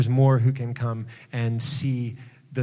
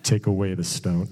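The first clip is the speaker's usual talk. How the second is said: a lower, laid-back cool take; a lightly cooler, deeper version; 9 semitones lower than usual. Retold or imitated. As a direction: say it cool and easy, dropping low. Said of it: "he said it in a much cooler way".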